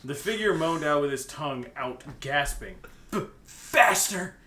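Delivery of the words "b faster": hammed-up moan. Dramatic reading of fanfic moan.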